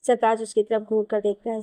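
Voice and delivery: ordinary delivery, nothing marked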